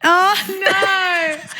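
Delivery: long, high wail of dismay; mock horror. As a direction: cry out in hammed-up horror. Mock horror at the fart story.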